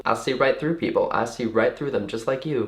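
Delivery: soft put-on Southern seer; hushed mock Southern voice; Southern accent. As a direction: imitate a knowing Southern lady. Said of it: "really bubbly and talkative and Southern".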